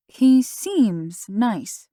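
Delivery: slow, with "seems" stretched and leaned on; "He seems nice" with a knowing stress on "seems"; emphasis on seems. A narrator's reading. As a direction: drag out "seems" so it sounds doubtful. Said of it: "with a slight emphasis on the word seems".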